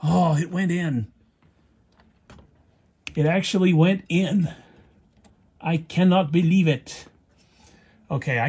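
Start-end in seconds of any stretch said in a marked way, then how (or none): 0.0-7.1 higher, amazed relief; real delight that it worked; surprised. Real, not acted. The clip finally goes back in.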